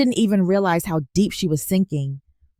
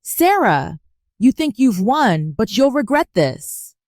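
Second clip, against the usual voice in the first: cracking shout; 5 semitones higher than usual. A narrator's reading. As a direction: voice breaking as she shouts. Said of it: "Her voice cracked as she shouted".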